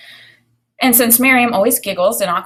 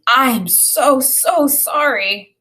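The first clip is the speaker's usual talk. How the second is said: higher, slow drawn-out apology; apologetic mother; 5 semitones higher, 4 dB louder, much slower than usual. A narrator's reading. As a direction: stretch out the sorry, mortified. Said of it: "Missus Green was saying".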